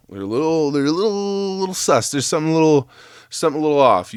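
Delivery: squeaky high mocking tone; high teasing voice. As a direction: tease them in a high pitch. Mocking car guys, high pitch.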